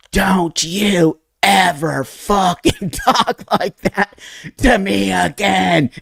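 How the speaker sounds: slow, high, strained mock yell; drawn-out high-pitched angry outburst, without full volume; retold yell. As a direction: re-enact yelling back, stretching each word. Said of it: "I literally yelled back at my dad".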